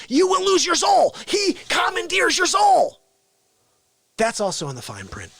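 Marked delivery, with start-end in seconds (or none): 0.1-3.0 high, loud mock-horror cry; dire warning. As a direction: proclaim it like a hammed-up doom prophet. Mock dire warning about villain.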